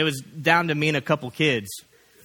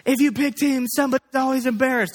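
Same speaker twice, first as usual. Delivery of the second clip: much higher, louder frantic shout; mom yelling stop; 12 semitones higher, 4 dB louder than usual. Retold or imitated. Youth group mom stopping team picking, shrill yell. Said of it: "she's like".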